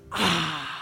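long refreshed sigh of relief; satisfied "ah". Shown as a demonstration. Showing the body's response to a big swig of water, satisfied sigh. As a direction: let out a satisfied "ah" after a drink. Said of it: "what's that satisfying, like, that response".

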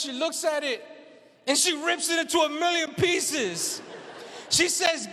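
ordinary talk

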